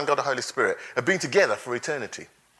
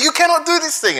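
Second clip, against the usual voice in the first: Peter protesting; 13 semitones higher, 5 dB louder than usual. Acted. Peter rebukes Jesus.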